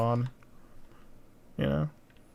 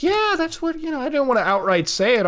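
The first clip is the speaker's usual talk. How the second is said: mock voice; 11 semitones higher than usual. Mocking the other person.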